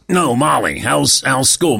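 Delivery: a hint of a gravelly smoker repeating; smoker's rasp. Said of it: "a friend of ours has a smoker's voice".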